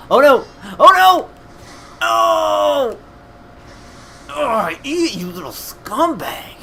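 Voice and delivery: loud, high-pitched cries of alarm; real gamer outburst, shrieked then snarled; panicked yelling. Real, not acted. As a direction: scream at the enemy that got you. Attacked in the game, yelling.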